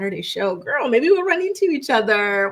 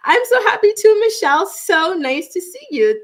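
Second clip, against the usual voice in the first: higher and louder, gushing with joy; light up and greet her much higher; excited; 10 semitones higher, 6 dB louder than usual. Real, not acted. Greeting a happy viewer.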